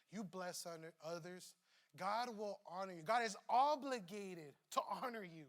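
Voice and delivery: usual speaking voice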